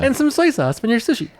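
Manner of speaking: high, put-on hostess voice; put-on female voice. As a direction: pitch it way up, putting on an act. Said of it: "that's not her real voice at all".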